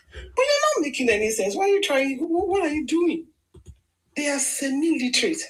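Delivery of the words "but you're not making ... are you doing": exasperated retort. Retold or imitated. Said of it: "They're like".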